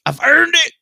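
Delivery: crying it out high and loud. Acted. Streamer insists to chat with a high loud cry. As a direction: wail the line up high. Repeated boast, cry heard.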